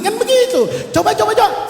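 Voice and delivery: loud, laughing through the words. Real, not acted. Preacher laughing at the scene of a mother's video call, loud laughter. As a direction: burst out laughing and egg them on.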